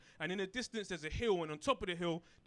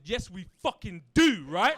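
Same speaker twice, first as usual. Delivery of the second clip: much louder, fired-up yell; emphatic shout; 12 dB louder than usual. Retold or imitated. Kid answering mum, loud indignant yell. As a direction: punch it out with outrage.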